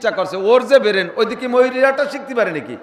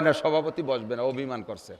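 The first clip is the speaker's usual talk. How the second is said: soft, lower voice for a sheepish organiser; quiet mock excuse; 9 semitones lower, 11 dB quieter than usual. Organiser quietly explains the sulking chairman, softly mocking.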